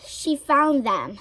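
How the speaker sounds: usual speaking voice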